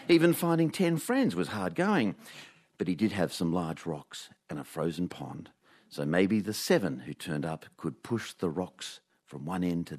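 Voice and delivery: dry, deadpan storytelling read; comic story read. Acted. Host reading his scripted news-from-nowhere segment, deadpan. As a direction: read the absurd tale like a straight-faced report.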